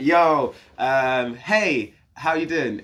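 high, loud breezy hello; overly casual greeting. Retold or imitated. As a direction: act too casual on purpose. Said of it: "So I'm like".

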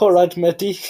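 hammed-up Pakistani-Australian accent; fresh-off-the-boat Pakistani-Australian accent. Co-host imagining an immigrant in Australia, mock Pakistani-Australian accent. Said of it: "fresh pot accent for a minute".

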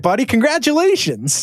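sarcastic congratulations to a disgraced owner; mock congratulations. Mock award to Snyder.

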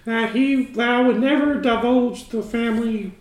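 high, loud, giggly put-on voice; secretive penguin farmer guarding the family recipe, high and giggly; high character voice. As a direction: squeal it out like a nervous secretive farmer. [name], high loud laughing voice.

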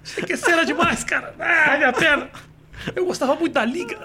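high-pitched gushing through mock pain; injured starstruck fan. Injured driver recognizing the comedian who hit him, starstruck groaning. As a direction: fawn and moan at once, comic imitation. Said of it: "eu sou um cara conhecido".

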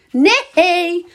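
high, stretched two-beat yell; loud, drawn-out protesting shriek. A narrator's reading. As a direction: yell the refusal in a high child's squeal. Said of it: "gilt Dirk nog harder".

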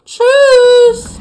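sudden high yell; shouted goodbye. Real, not acted. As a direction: squeal it out loud. Shrill farewell during boss fight.

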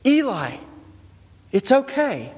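put-on high, gentle comforting to a crying boy; soothing a scared child. Father soothing his son after a nightmare, gently. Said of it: "lifting Eli's head when he's screaming".

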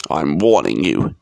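low, forceful uncle character; menacing uncle. A narrator's reading. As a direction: warn him with low menace. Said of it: "putting a large purple face right up".

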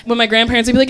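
ordinary talk, nothing marked

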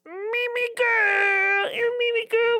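put-on high, slow squealing baby talk; gushing baby talk to a dog. Shown as a demonstration. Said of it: "this voice comes out in me".

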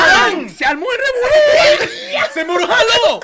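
high, loud storytelling yell; explosive high-pitched comic shout; shouted climax. Retold or imitated. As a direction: holler the brawl breaking out.

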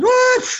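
resoplido agudo y fuerte de enojo fingido; bufido exagerado. Acted. Reacción al ser ofendido. ("uf" de fastidio, agudo y fuerte)

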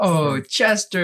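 cooing at dog. Real, not acted. Speaker greeting the dog Chester, real cooing.

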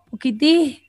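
lightly voiced dying grandmother, thin and faint; a hint of a frail old woman fading. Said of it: "una abuelita ya está en sus últimos días". (una abuelita débil, apenas audible)

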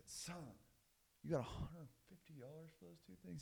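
high, soft incredulous question; acted disbelief. Dad repeats it in disbelief.

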